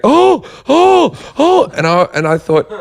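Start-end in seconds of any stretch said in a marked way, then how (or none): 0.0-1.7 high, far-off mock "oh, oh" of fluttering bystanders; imitating swooning onlookers. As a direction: flutter these much higher like gasping onlookers. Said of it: "standing around going".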